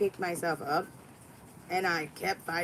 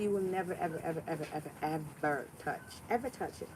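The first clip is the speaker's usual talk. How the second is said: ever, ever, ever repeated softer each time; fading repeated ever; 2 semitones lower, 5 dB quieter, faster than usual. Real, not acted. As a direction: let the "ever" trail off quieter and quieter.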